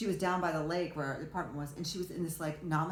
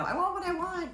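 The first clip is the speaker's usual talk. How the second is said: put-on high, louder childish demand; mock tantrum; 14 semitones higher, 5 dB louder than usual. Mother mocking her own tantrums, high tantrum voice. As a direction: whine it out like a kid throwing a tantrum. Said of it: "I'm just throwing a temper tantrum".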